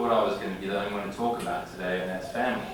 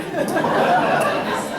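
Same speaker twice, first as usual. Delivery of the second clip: high, loud burst of real laughter; 11 dB louder than usual. Real, not acted. Laughing aloud at the coach who keeps calling the family.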